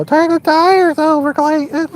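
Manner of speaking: high-pitched mock teenager; teenage voice impression. Shown as a demonstration. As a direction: imitate a teenage kid egging him on. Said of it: "my best impression of a teenage voice".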